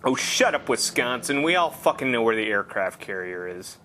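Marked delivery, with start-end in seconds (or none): none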